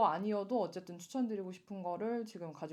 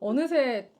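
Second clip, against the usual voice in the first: laughing; 3 semitones higher, 9 dB louder, much slower than usual. Real, not acted. Laughing about mom taking shoes.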